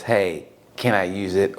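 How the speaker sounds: low, slow imitation of a casual ask; subdued, drawn-out mock question; mock request.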